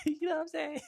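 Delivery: high, laughing talk; high-pitched giggly check-in. Real, not acted. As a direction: squeeze the words out through real laughter. Laughing at own boast.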